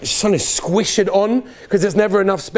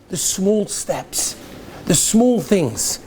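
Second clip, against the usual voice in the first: "small" drawn out slowly, softer; slow emphasis; 3 dB quieter, much slower than usual. Acted. Rabbi urging small steps, slow stress on small. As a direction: lean slowly on "small" each time.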